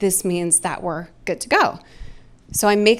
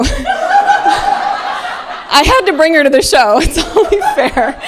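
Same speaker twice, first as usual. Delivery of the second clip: laughing; 8 semitones higher, 8 dB louder, much slower than usual. Real, not acted. Laughing at her daughter in the crowd.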